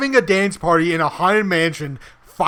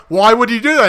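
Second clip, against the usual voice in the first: higher, louder, baffled; upset homeowner; 5 semitones higher, 5 dB louder, faster than usual. Retold or imitated. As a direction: demand an answer, outraged. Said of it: "he's just going".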